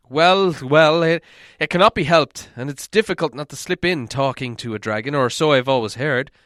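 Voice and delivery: put-on an old dwarf soothing a friend; Balin.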